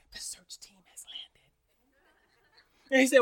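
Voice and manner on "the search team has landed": slow, secretive whisper; whispering child. Acted. Son reporting the search team to the boss, whispering. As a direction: whisper it as hushed news. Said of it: "He's whispering".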